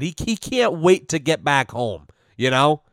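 ordinary talk

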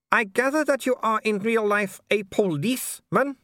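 high, polite baroness voice with a thin smile; a smiling aristocrat who means none of it; cool hostess smile. A narrator's reading. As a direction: welcome him coolly through a fixed smile.

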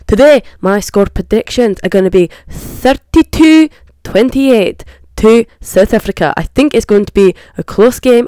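loud put-on Scottish accent drifting; presenter's accent sliding off course; wandering mock accent. Vlogger giving score predictions, loud drifting mock Scottish accent. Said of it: "I don't know where this accent's going".

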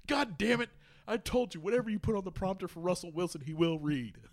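high-pitched mock scolding; exasperated outburst. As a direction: berate the crew over a blunder. Acted fed-up crew over prompter reading.

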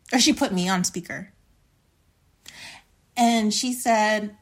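ordinary delivery

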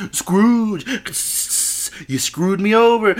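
ghostly business partner. Acted. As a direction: wail it like an accusing ghost. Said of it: "Who was Ebenezer Scrooge, Scrooge's business partner".

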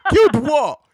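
high, loud incredulous retort; indignant disbelief. Retold or imitated. Host retorting to fans praising the accent, indignant disbelief. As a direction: throw the praise back, loudly outraged.

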